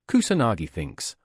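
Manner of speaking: ordinary delivery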